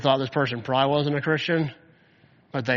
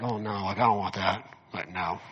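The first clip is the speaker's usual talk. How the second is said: low, softer mock reluctance; acted unwilling refusal, pitched down; reluctant dread; 5 semitones lower, 5 dB quieter than usual. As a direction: back away from it in a lowered voice. Voices someone dreading Christ's return.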